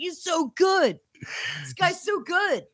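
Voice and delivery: high, soft and slow; hushed awed gushing. Retold or imitated. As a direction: gush in a hushed awe. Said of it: "he's like".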